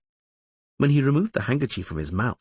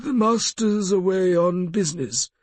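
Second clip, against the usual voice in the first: higher, squeaky dim-witted servant; squeaky half-wit voice; 10 semitones higher than usual. Acted. The lawyer fakes being a dim butler to strangers, squeaky. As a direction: play the fool in a thin squeak. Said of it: "speaking with a slightly squeaky voice".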